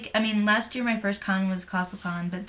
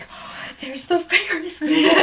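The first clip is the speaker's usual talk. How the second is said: excited quote; 9 semitones higher, 4 dB louder, slower than usual. Retold or imitated. Excited arrival at the convention.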